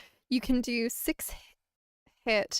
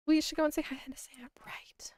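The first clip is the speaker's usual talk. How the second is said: hushed conspiratorial whisper; 4 semitones higher than usual. Acted. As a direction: whisper it like a secret. Whispered aside.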